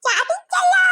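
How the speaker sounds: high, drawn-out cry of alarm; dismayed shout. Acted. Someone crying out at being betrayed, dismayed shout. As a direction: cry out as if all is lost.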